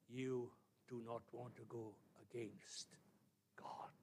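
quiet, deliberate, spaced-out solemnity; grave warning. Real, not acted. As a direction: drop to a soft, weighty warning. Warning repeated slowly and softly.

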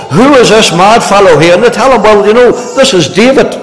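preacher retelling David's flight, fervent; preaching. Real, not acted. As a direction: build the story with fervour. Preaching builds.

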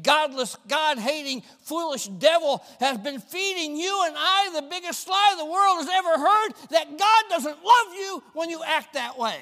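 rising high and loud against the devil; fiery preaching. Real, not acted. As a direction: build the fury line by line. Preaching at full force.

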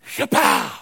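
loud, high "shapow" burst; imitated whip crack. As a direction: let it crack like a hard lick. Imitating the next blow.

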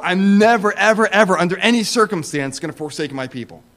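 loud, punched never, ever, ever; Jesus promising never to forsake, emphatic; emphatic repetition. Acted. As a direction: hit each ever harder. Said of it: "It's that emphasis, repeating it for emphasis".

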